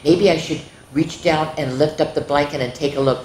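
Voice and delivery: ordinary delivery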